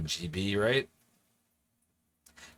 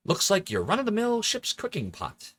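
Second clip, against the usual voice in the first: put-on a light, higher take on a young adventure-game hero; Dominic's Guybrush voice; 10 semitones higher than usual. Streamer reading the game text as Dominic's Guybrush, lightly voiced. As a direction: read the game line like the hero's voice actor. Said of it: "I'm trying to do my best Dominic impression".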